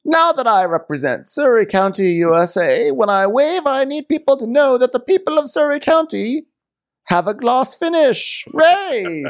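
high, hammed-up grande dame; Karen. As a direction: announce it theatrically in a high voice. Co-host continues Karen impression.

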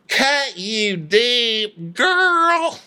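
very slow, climbing high drawl; mocking echo. Co-host mocking Diana's melodrama, high drawn-out echo. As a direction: stretch it out, rising to a squeal.

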